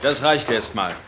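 loud, high, brisk orders; calling out. Acted. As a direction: push the team to hurry. Busy calling orders in print room. (laute, eilige Anweisungen)

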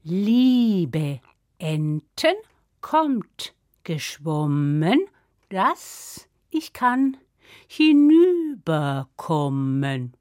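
very slow, stretched-out spell chanting; magic incantation. Acted. Sister calls ducks to cross river, incanting. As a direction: intone each word solemnly, vowels drawn long.

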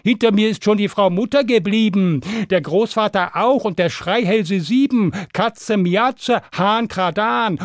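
high, affronted boasting; indignant boastful pancake. A narrator's reading. Pancake scoffing at the cow, high indignant boasting. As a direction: scoff loftily, high refusals at the end. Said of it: "sagte da der Pfannkuchen".

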